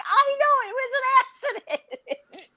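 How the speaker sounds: high, giggling through the excuse; laughing. Real, not acted. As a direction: laugh it out, embarrassed. Retelling her reply, laughing.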